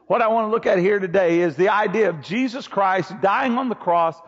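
normal talking voice

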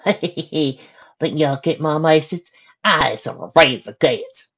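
slow, loud southern drawl; southern accent. A narrator's reading. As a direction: drawl it like a cocky southern boy. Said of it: "the southern boy".

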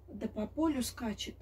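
ordinary delivery, nothing marked

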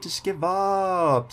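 stretched, slow and whiny; slow pleading drawl. Real, not acted. Gamer begging his chaser to quit, slow and whiny. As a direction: drag every word out begging. Drawn-out plea to rival.

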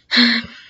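loud heavy exhale; sigh. Real, not acted. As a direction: let out a big, loud sigh. Lost track of topic.

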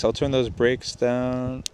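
ordinary talk

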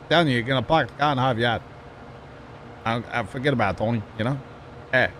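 put-on a light Boston accent caricature; mock Boston accent. Teasing Sean about Harvard Yard, Boston accent. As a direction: drop the R's like a Bostonian. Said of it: "I'm making fun of Sean".

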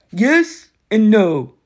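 lightly voiced cocky war god, taunting; a hint of a swaggering bully, amused; smug war god. Ares answers slowly.

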